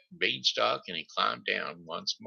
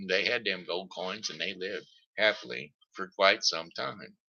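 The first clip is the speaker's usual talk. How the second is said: ordinary delivery, nothing marked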